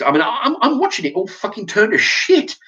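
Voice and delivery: high, laughing through the words. Real, not acted. Ranting man laughing at society falling apart: real laugh. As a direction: laugh in disbelief as you say it. Laughing at his own rant.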